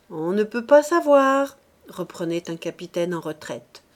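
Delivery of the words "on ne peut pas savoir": a hint of a raised old officer; lightly voiced captain telling his tale; retired captain. A narrator's reading. Captain answering the gossip, lightly voiced.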